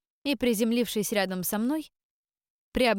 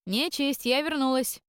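восклицая; 5 semitones higher than usual. Acted. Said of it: "восклицаю".

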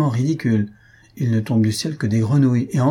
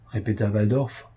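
ordinary delivery

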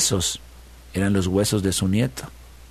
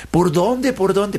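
eager coyote; 8 semitones higher, much slower than usual. A narrator's reading.

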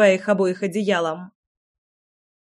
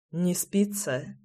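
soft, slow night question; quiet; 8 dB quieter, much slower than usual. A narrator's reading. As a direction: ask it softly so as not to wake anyone. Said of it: "тихо спросил Райлих".

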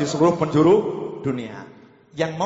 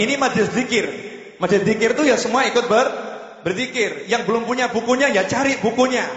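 raised, high exhorting sermon voice; preaching at full force; 7 semitones higher than usual. Acted. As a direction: rouse the congregation to chant along. Urges the congregation to chant.

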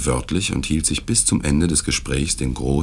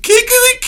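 shrill high cock-a-doodle-doo, much higher and louder; rooster crow; 9 dB louder than usual. A narrator's reading. Old lady mimicking a rooster to amuse the guests, rooster crow. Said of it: "ihr Krähen war einfach entzückend".

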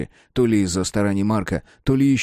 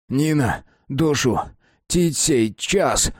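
pleading; 5 semitones higher, much slower than usual. A narrator's reading. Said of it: "умоляю я".

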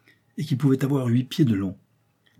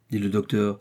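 plain reading voice

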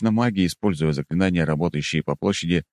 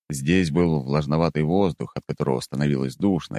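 usual speaking voice

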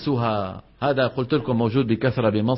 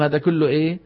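usual speaking voice

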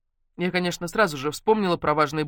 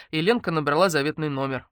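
ordinary delivery, nothing marked

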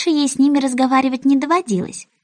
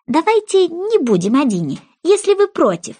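higher, pleading voice; higher placating plea to the mouse; 8 semitones higher than usual. Acted. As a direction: soothe the mouse, rising high.

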